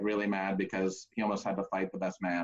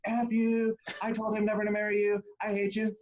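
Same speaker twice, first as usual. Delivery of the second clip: put-on high, belligerent drunk voice; mock drunk yelling; drunk best man; 11 semitones higher than usual. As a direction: spit it at her pitched way up.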